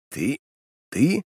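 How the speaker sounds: halting, disbelieving repeated question; falter, leaping up on the repeat; stammering. A narrator's reading. Startled uncle questioning his niece, stammering. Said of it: "заикаясь, произнёс Вестон".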